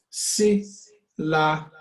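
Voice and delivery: SYLLABLES in three slow separate beats, si, la, bles. Shown as a demonstration. Teacher breaks the word up for counting, syllable by syllable. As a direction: break SYLLABLES apart with clear gaps. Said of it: "listen to the way you say words".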